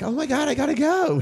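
high-pitched hurried excuse; mock urgent exclamation. Excusing herself from the date, mock urgency. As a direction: blurt it high to get away.

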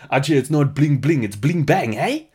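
swooping mock South African with rising tag; Leonardo DiCaprio. Host redoing DiCaprio's bar line, South African accent. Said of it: "It's like".

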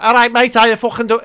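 lightly voiced rough lad; rough bloke voice. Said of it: "rough as fuck".

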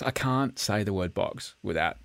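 ordinary delivery

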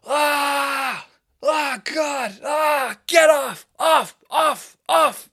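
high, loud mock heckling shouts; jeering crowd. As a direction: yell like a whole room rejecting the act. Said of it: "the whole crowd is like".

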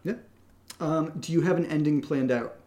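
normal talking voice

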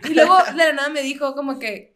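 ordinary talk, nothing marked

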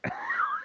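shrill unvoiced laugh burst; high squeal of laughter. Real, not acted. Laugh after the story.

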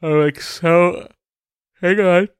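high, stretched yawn mid-sentence. Real, not acted. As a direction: push words out through a yawn. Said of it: "I apologize for the yawn".

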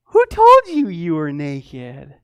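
loud start, slowly drawn out; mock questioning. God catching Adam in hiding, mock questioning. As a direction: pounce on it, then stretch it out.

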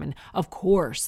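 ordinary talk

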